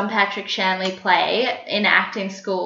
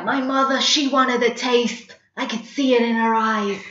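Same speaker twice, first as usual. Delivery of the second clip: New York accent; 3 semitones higher than usual. Shown as a demonstration. Continuing the play's monologue from acting school, New York accent. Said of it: "learnt to do a New York accent".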